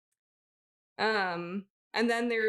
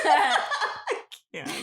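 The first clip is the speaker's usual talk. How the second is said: laughing; 7 semitones higher, 7 dB louder than usual. Real, not acted. Laughing burst at start.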